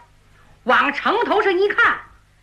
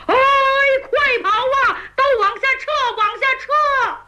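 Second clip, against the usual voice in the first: much higher, urgent yelling to retreat; panicked shout; 10 semitones higher than usual. Acted. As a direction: bellow at the troops to run. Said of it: "一边跑一边喊". (牛皋边逃边慌张地高喊撤退)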